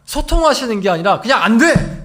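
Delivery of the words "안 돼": loud, abrupt forbidding shout; sharp no. Retold or imitated. Preacher voicing God's flat refusal, loud sharp no. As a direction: bark the no like a stern father. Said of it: "이렇게 말씀하세요".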